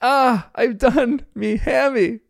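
high, slow, hammed-up wail; mock yell of pain. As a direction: imitate a dad crying out in pain, drawn out. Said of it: "yells in pain".

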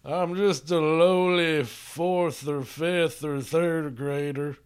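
small-town lawyer. Acted. Co-host mocks scared friend pleading to ma'am, meek lawyer voice. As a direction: draw it out like a meek country lawyer. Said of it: "small town pizza lawyer".

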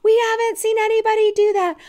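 very high, loud excited cheer; cheering family. Retold or imitated. Family cheering a wild sled jump, high and excited. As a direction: shriek it like amazed onlookers. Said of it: "They're like".

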